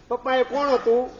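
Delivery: put-on high, childlike asking voice; a small boy tugging at his father with a question; curious little son. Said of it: "તમારો દીકરો પૂછે". (નાના દીકરાનો ઊંચો, કુતૂહલભર્યો અવાજ)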